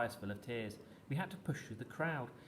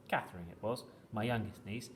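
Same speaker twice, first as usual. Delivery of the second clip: plain reading voice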